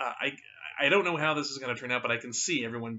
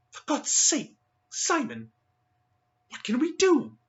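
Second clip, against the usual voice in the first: much higher, louder desperate plea; frightened Linnet; 14 semitones higher, 8 dB louder, slower than usual. Acted. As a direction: beg him in a panic. Linnet panics after the encounter.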